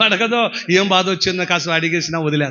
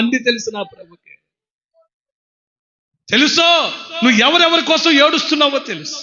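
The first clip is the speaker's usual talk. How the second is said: preaching at full force; 8 semitones higher than usual. Acted. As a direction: cry it out to the hall.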